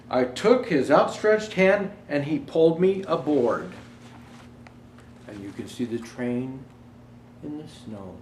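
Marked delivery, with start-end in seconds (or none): 0.1-3.7 put-on storybook reading for children; gentle read-aloud to kids; story narration. Reads picture book aloud.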